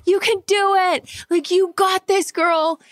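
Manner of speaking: cheering on the surrogate through delivery like fans; sports fan cheering. Retold or imitated. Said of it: "turned into, like, the biggest sports fan".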